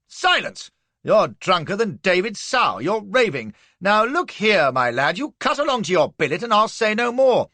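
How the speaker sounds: loud, high officer's roar; angry shout. A narrator's reading. Colonel roars at the insolent trooper to get out. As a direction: blast him into silence.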